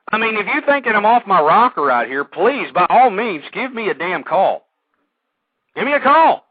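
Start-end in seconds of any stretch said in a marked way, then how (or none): 2.2-4.7 slow insistence. Real, not acted. Inviting critics to call. 5.7-6.4 high, loud shout of Give me a call; loud invitation. Real, not acted. Host daring critics to phone in, shouting.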